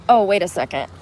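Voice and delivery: ordinary talk, nothing marked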